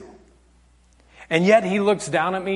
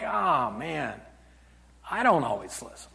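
lower, soft, sheepish admission; deflated realisation; 6 semitones lower, 10 dB quieter than usual. Acted. Preacher admitting he shares the fault, deflated. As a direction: sink down as if caught out.

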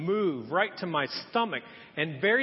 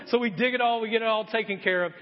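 ordinary talk

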